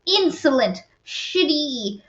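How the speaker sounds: theatrical scorn, word by word; indignant insults. Retold or imitated. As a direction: spit out each insult separately, hammed-up. Retold insults written in her notes.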